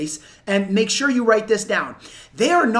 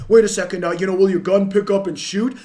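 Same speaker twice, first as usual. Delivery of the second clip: argumentative salesman; 4 dB louder than usual. Retold or imitated. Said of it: "talking to him like".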